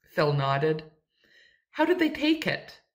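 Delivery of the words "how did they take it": high, curious question; eager question. A narrator's reading. Eager question about Phil's family.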